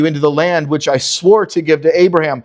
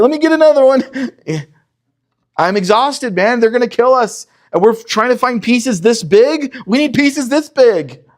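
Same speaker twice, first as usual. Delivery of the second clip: much higher and louder, complaining; exasperated overworked slave; 11 semitones higher, 4 dB louder than usual. Acted. Hebrew slave gathering straw complains to a fellow worker, frantic. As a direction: whine like a worker pushed past his limit.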